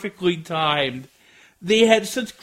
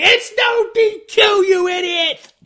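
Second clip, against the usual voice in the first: high, loud, drawn-out shout; imitated yell; 12 semitones higher, 6 dB louder than usual. Wrestler berating the referee over the count, imitated yell. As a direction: holler it in outrage, imitating an angry wrestler. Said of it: "he yells at the referee".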